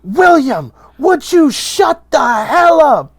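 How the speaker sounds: high, slow, loud shouting rebuke; mother losing patience with her drunk son; furious mother. Acted. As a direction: yell at him to shut up. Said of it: "And she goes".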